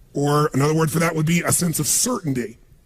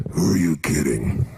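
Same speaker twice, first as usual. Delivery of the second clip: much lower, slow, strange scoffing voice; doubting inner voice; slower than usual. Retold or imitated. Speaker voices the inner doubting voice, low and slow scoff. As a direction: sneer like the doubt inside your head. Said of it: "this voice in your head goes".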